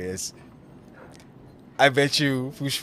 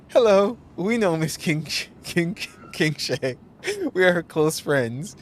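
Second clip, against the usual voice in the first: nervous high bluff; 7 semitones higher, 4 dB louder than usual. Acted.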